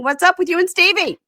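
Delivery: loud, high-pitched nosy demand; mock interrogation. Boyfriend grilling Claire at the table, loud mock interrogation. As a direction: blurt it out as an over-the-top accusation.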